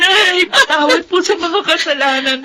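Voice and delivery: high, crying apology; a daughter weeping for forgiveness in her mother's arms; sobbing plea. Acted. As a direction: beg forgiveness between sobs.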